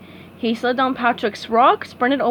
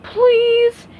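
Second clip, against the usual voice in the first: pleading; 12 semitones higher, 7 dB louder than usual. A narrator's reading. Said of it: "he pleaded".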